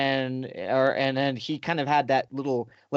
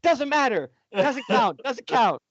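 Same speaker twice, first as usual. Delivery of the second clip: put-on whiny girl; 10 semitones higher, 4 dB louder than usual. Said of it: "she's like".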